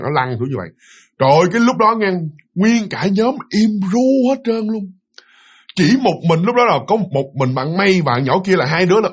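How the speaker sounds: ordinary talk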